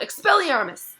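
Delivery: high, loud spell cry; Harry disarming Lockhart; bellowed spell. A narrator's reading. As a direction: blast the word out. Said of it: "Harry bellowed".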